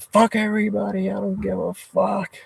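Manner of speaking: mock top-of-the-lungs rage at the family; retold furious yell. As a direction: yell it again as if the family were still pleading. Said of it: "yelling at the top of my lungs".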